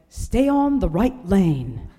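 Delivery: put-on slow sat-nav voice, New Zealand accent. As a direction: read it like a Kiwi GPS, slowly. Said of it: "speaks with a New Zealand accent".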